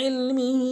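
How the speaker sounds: ইলমিহি with the ayn pressed from mid-throat. Shown as a demonstration. Teacher demonstrating Quran recitation, ইলমিহি with throat ayn. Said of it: "আইন এনে উচ্চারণ করব".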